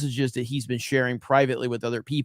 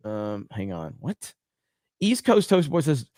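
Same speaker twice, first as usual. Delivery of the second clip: ordinary talk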